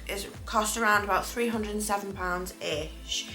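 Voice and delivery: normal talking voice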